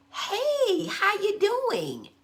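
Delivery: very high, drawn-out social hello; friendly greeting. Shown as a demonstration. Showing how people greet each other, gushing and high. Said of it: "your voice goes up at the end".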